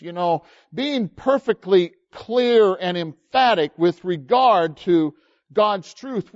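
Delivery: ordinary talk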